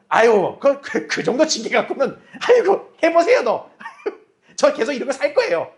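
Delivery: defiant taunt breaking into laughter; mock the punishment with a laugh; cocky laughing. (웃으며 비아냥거리는 버티는 신자 말투)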